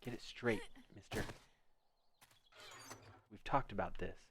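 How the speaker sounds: normal talking voice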